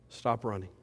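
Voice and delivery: quiet plea. Real, not acted. Preacher urging congregation.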